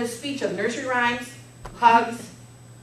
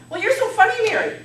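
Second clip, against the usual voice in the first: relative teasing; 10 semitones higher, 6 dB louder, faster than usual. Retold or imitated. Relatives teasing her at dishes.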